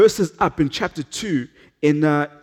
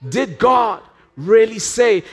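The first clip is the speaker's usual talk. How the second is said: shouted tempter's question; 3 semitones higher, slower than usual. Acted. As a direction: fling the question out like an accusation. Satan comes in and he says.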